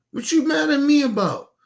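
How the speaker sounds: high-pitched, indignant question; real exasperated disbelief; indignant challenge. Real, not acted. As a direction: demand an answer, pitch shooting up. Challenging those mad at him.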